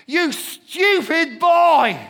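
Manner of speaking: high, loud, slow furious yell at his son; exasperated dad shouting at his clumsy teenage son; angry outburst. Retold or imitated. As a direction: bellow it, dragging each word out. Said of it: "first thing I said to Josh was".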